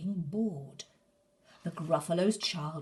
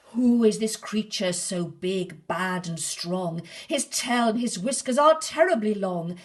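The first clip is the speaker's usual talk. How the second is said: much louder frightened cry; terrified child; 3 semitones higher, 11 dB louder than usual. Acted. Gruffalo's child sees the terrible shadow, loud and scared. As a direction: panic at the huge shadow.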